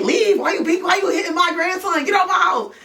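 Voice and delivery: hammed-up grandma yelling at the intruder; grandmother yelling. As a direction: throw her out of the house. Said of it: "she was like".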